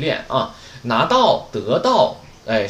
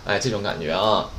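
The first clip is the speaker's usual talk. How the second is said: ordinary delivery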